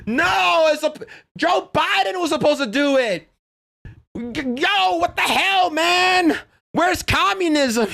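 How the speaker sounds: high wailing mock despair; mocking Hassan. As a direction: whine like a sore loser. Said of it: "He's like".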